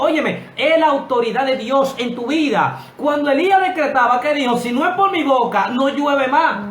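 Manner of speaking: high, loud preacher's cry; fiery preaching. Acted. Preacher stirring up his congregation, loud fiery preaching. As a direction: preach it to the rafters, full force.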